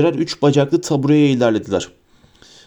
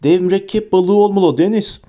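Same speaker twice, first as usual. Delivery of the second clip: higher-pitched excited exclamation; 6 semitones higher, 3 dB louder than usual. A narrator's reading. Colin tells Deniz his guess about the creature, excited. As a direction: tell it with thrilled excitement. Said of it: "onunkine denk bir heyecanla".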